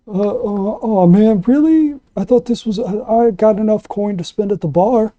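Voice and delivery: a mopey guy let down by the tip rule, pitched well up; whiny sad sack. Acted. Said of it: "Sad Boy McGee goes".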